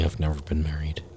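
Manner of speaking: whispered aside. Real, not acted. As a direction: drop to a whisper to confess. Confessing joke aside.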